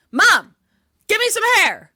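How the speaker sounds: high, loud mock yell; mock demand. Listener butts into the story as the kid, mock demanding yell. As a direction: call out high and loud to Mom. Acted shouted demand to Mom.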